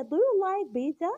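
slow, clear question with a rising end; slow question drill. Shown as a demonstration. Teacher modelling a question for parents to ask their kids, slow and rising. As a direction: model the question for a child, word by word. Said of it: "ونسألهم مثلًا".

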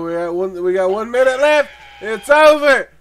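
rising, loud time warning; urgent call-out. Real, not acted. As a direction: build to a shout as time runs out. Calls out the time running out.

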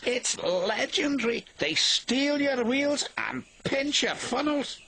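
high, slow spooky storyteller voice; spooky teasing. Acted. Douglas teasing Percy with a spooky tale. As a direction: spook the little engine on purpose.

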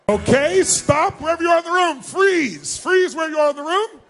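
loud call to the whole room to freeze. Real, not acted. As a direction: shout across the hall so everyone stops.

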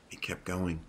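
soft, hushed reply; subdued, near-whispered answer. A narrator's reading. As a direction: say it under your breath. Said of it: "Marco whispered".

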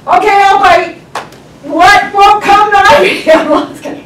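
high, loud mock yell; panicked driver shouting at the heavens; frantic retort. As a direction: yell it back in exasperated disbelief. Acted frantic retort to God.

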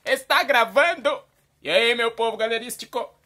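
loud, high, drawn-out opening shout; hyped video intro. Pretend. Host kicks off the video for the viewers, hyped shout. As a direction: belt out the greeting with big energy.

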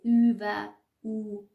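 او و او said slowly as "ü" and then "oo"; first او a front ü, second a back u, slow and loud; ü versus u. Shown as a demonstration. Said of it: "تفاوت این دو تا حرف رو".

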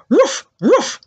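two high imitated dog barks; playful "woof woof" imitation. Shown as a demonstration. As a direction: bark twice like a puppy. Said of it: "And they say".

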